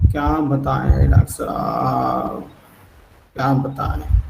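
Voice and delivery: slow, a hint of weary suffering; weary complaint. Doctor imitates the suffering patient answering him, slow and weary. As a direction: drag the words out like a tired patient in pain. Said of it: "ये इस type की tone.".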